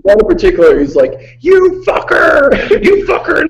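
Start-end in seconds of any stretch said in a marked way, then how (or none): 1.4-3.5 high-pitched, drawn-out mock scream; imitated screaming. Retelling a castmate's nonstop rant to the hosts, mock screaming. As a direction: mimic a screamed insult, stretching it high. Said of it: "him screaming without stop".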